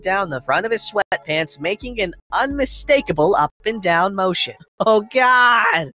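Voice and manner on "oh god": high, loud cry of dismay; horrified exclamation. Acted. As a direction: exclaim it, appalled at the sight. Narrator's horrified reaction to sight.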